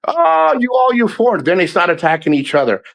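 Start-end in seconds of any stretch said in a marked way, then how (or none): 0.1-1.4 high, sneering "all you foreigners"; mocking high voice. Mocking preachy expats lecturing other foreigners, high and sneering. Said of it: "wanna change everybody".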